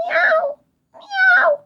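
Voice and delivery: very high tiny meowing; kitten mewing. Acted. Frightened kittens mewing in the attic, tiny high voices. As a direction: mew like scared little kittens. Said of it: "miauczały".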